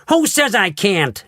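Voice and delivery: slow, high defiant shout; defiant bratty shout. A narrator's reading. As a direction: challenge him like a spoiled child. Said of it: "shouted Veruca".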